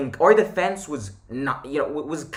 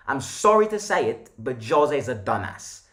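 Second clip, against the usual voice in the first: normal talking voice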